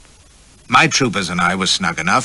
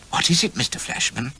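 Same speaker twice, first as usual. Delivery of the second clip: higher, light lady's voice; young lady voice; 7 semitones higher, 3 dB quieter than usual. A narrator's reading. Female character voice.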